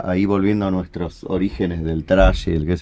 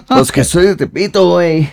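higher, louder chilango street voice; Tepito Mexico City accent; 7 semitones higher, 5 dB louder than usual. Acted. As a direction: play a rough Tepito local. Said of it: "tengo que preparar el personaje".

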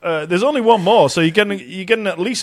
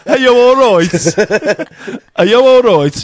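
gleeful mock Brummie, raised pitch; Birmingham accent; 3 semitones higher, 5 dB louder than usual. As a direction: stretch it out in broad Birmingham. Acts the Birmingham sentence after guess.

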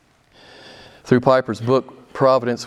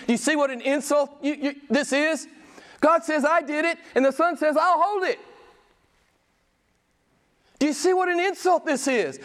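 high, pleading preacher's challenge; impassioned preaching; 12 semitones higher than usual. Acted. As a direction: press the congregation hard.

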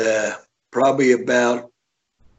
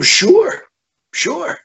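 stunned agreement; 4 dB louder than usual. Retold or imitated. Retelling his stunned reply.

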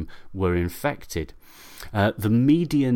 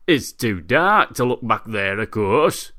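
put-on louder, matter-of-fact drunken voice; drunk Arthur; 5 dB louder, slower than usual. Drunk Arthur explains himself to his neighbour, smug drunken certainty. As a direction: state the absurd reason as if it's obvious.

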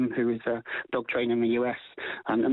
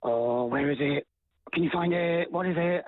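drawn-out "oh", then higher playful questions; silly, playful; 4 semitones higher, slower than usual. Shown as a demonstration. Trainer showing owners how to excite the dog, silly. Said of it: "start being a bit silly and you go".

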